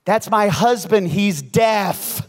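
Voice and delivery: loud, slow heckler's yell. Retold or imitated. Wife to pastor from the crowd, yelling out. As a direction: holler it across a crowded church. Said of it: "someone from the crowd yells out".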